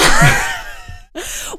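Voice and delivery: loud, high, breaking-up laugh; helpless laughter. Real, not acted. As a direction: keep cracking up, unable to stop. Still cracking up.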